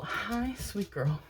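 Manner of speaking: hushed, affectionate sing-song greeting; tender aside. Real, not acted. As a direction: coo it quietly, as to a beloved pet. Aside greeting to a pet or child.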